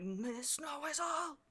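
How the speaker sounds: slow, high, drawn-out cry to the sky; wailing lament. Acted. Leader left alone cries out to the sky, wailing. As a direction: shake a fist and wail the name.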